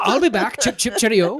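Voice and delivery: Arnold lines in a high mock British accent. Mock British dub of Arnold, high and chirpy. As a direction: play the Terminator as a chirpy Brit. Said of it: "radio D J".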